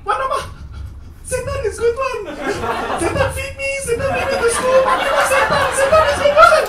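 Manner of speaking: put-on high loud laughing boy defending dad; pleading kid. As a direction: insist earnestly like a small child. Boy defends his father.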